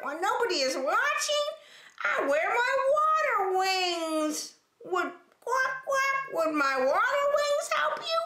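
very high squeaky duck voice with quacks; quacking duck. Acted. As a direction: play a nervous little duck. Duck character speaks.

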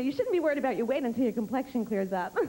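ordinary talk, nothing marked

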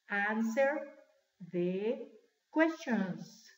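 very slow, pauses between words. Shown as a demonstration. Teacher asking class to repeat, word by word. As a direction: model it slowly for repetition. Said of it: "Tres palabras separadas".

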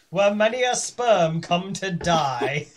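loud slow laughing delivery; booming through laughter. Real, not acted. Joke line, laughing, loud.

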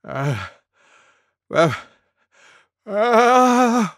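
spaced-out ah, ah, aah before sneezing; sneeze build-up. A narrator's reading. Elephant about to sneeze, building gasps. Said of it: "went Elephant".

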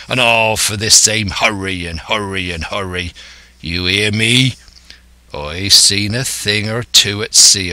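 scornful West Country sea-dog; rolling pirate rant with leaning stresses; old pirate, exasperated. Acted. Silver scolding his impatient crew, exasperated pirate.

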